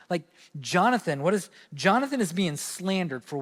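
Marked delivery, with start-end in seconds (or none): none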